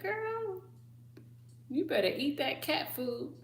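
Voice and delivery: high sassy girl, a mock warning. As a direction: owner warning the greedy cat. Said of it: "I'd be like".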